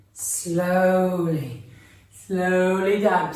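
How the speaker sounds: drawn-out slow. Shown as a demonstration. Teacher guiding children to sink slowly, drawn out. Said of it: "sink down slowly".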